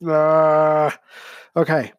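choking a little on water; strained after swallowing wrong; coughing up water. Real, not acted. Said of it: "that went down the wrong pipe".

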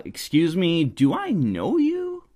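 high, drawn-out questioning lift at the end; mock puzzlement. Speaker answers the confronting stranger, mock puzzled. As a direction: act out a baffled, pointed question.

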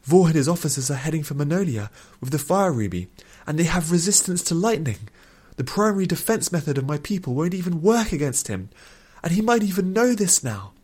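high, alarmed pleading. A narrator's reading. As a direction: explain the danger in a desperate, high voice. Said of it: "said Nuthia pleadingly".

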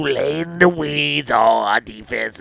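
ordinary talk

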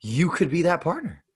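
sarcastic. Pretend. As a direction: sell the false hope with slow, exaggerated sincerity. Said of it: "I'm being sarcastic".

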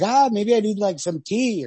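high, airy musing writer; dithering Beckett. Retold or imitated. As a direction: muse aloud high, idly wanting tea. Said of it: "It's like".

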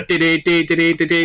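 quick sung dee-dee ticker; news ticker jingle. Retold or imitated. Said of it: "sort of news headline thing".

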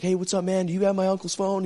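imitating a guy calling out from his car; arriving nephew.

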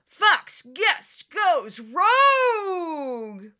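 dramatic show-title announcement; segment announcer. Pretend. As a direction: boom it like a TV promo announcer.

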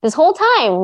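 high, loud, alarmed asking; mock panic, voice jumped up; retold alarm. Writing to her teacher, half-panicked and excited. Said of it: "I was like".

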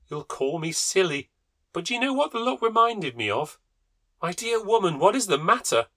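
high, bright gossiping lady voice; cheerful neighbour. A narrator's reading. Neighbour telling Amanda about the hunt, high chatty voice. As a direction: chat breezily about the kill.

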